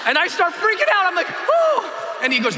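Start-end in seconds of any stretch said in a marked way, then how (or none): 1.3-2.2 high panicked yelp; sudden fright. Retold or imitated. Jumper freaking out before his turn, high panicked yelp.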